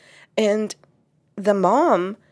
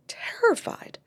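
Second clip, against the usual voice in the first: retold whisper; 14 semitones higher, 5 dB quieter than usual. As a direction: repeat "terrified" as a breathy whisper with no voice. Mom confesses fear in session.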